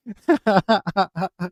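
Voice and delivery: brief amused laugh; light chuckle. Real, not acted. Laughing at own joke.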